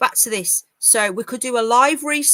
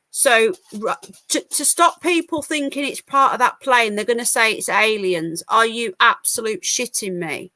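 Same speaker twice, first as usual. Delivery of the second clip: normal talking voice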